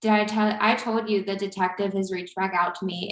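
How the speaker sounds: ordinary delivery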